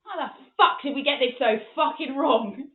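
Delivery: loud, slow, bewildered outburst; imitated father's loud lament; exasperated dad. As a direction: hammer out each word in disbelief. Dad's exasperation, acted.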